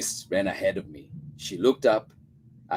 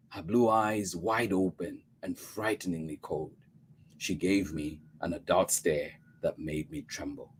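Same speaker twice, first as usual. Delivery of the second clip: plain reading voice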